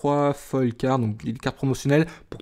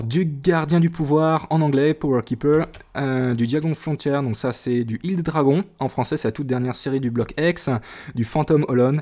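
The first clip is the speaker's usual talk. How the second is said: ordinary delivery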